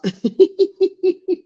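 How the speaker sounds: high rapid giggle; real burst of high giggles; laughing. Real, not acted. Laughing while tending to child.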